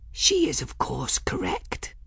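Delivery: hushed whisper, falling away. A narrator's reading. Folly whispering to her jar of crystals, whispered. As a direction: whisper it as a private aside. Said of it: "Folly whispered to her jar".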